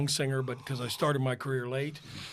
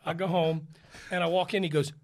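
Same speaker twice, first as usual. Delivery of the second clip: ordinary talk, nothing marked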